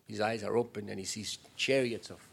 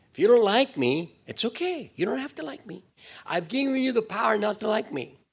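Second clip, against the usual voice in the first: much higher, light mock God voice; voicing God; 11 semitones higher, 7 dB louder than usual. God telling the unbeliever it's okay, high and mocking. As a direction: play God shrugging it off. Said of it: "God says".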